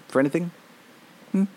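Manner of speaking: usual speaking voice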